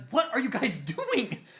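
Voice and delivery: high, loud, half-laughing Sakura voice; mock Sakura whine. Sakura scolds the two boys, high and laughing. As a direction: squeak it out through a laugh.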